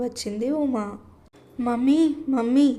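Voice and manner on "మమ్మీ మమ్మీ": put-on a boy calling his mummy from the bedroom; lightly voiced little boy shouting for mum; child calling out. A narrator's reading. Son calling his mother from the bedroom, lightly voiced child. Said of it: "బెడ్రూమ్లో నుండి అరుపు వినిపించింది".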